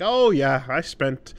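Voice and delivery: a touch of a boastful local reminiscing; mock local. Imagined westerner recalling Montreal.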